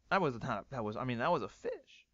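normal talking voice

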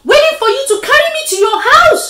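high, loud, fast shout; worked-up storybook spider; exasperated retort. Acted. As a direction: fire it back loudly in a rush. Bitten spider answers crossly.

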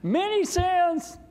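put-on high, slow shout of the old man's words; imitating dad yelling out happily; yelled out. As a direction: call it out loud and high like dad did. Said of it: "my dad yelled out".

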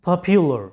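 পপুলার as pop-yu-lar, with a y-glide; correct pronunciation. Shown as a demonstration. Teacher shows students the right word, correct pronunciation. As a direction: model পপুলার English-style with "pyu". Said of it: "সঠিক উচ্চারণটা হচ্ছে".

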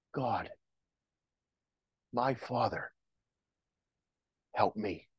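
low, soft, halting prayer; hushed plea with long pauses; quiet cry to God. Acted. Believer turning eyes to God, low hushed plea. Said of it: "Cry out to God".

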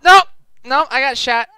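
high, loud yelp of defeat; frustrated yelp. Real, not acted. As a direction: blurt it out as you get hit. Getting shot in game.

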